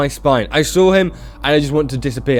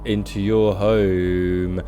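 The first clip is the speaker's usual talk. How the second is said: low, slow, ominous stranger; slow, menacing caller at the door; creepy visitor; 5 semitones lower, much slower than usual. Acted. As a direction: draw it out low like an eerie visitor. Voicing eerie visitor at the door.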